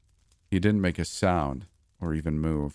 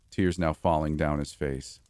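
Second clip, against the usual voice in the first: usual speaking voice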